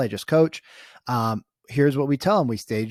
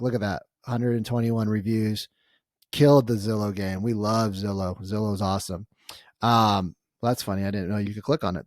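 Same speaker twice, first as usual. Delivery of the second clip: ordinary talk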